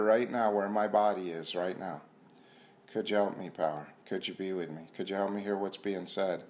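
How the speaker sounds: quiet prayer. Acted. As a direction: pray under your breath to the power. Quiet prayer to higher power.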